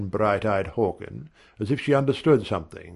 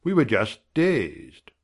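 ordinary delivery

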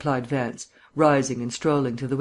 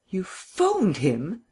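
slow, higher-pitched disbelief; stunned, stretched-out echo question; incredulous question; 5 semitones higher, much slower than usual. A narrator's reading. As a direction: draw it out in astonishment, rising at the end. Markham learns of Vance's meddling.

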